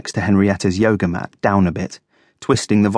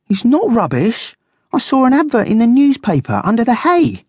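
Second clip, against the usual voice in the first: high, indignant guinea pig; high-pitched, defensive insistence; getting cross; 4 dB louder than usual. A narrator's reading. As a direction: protest hotly in a squeaky-high voice. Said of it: "beginning to feel quite cross".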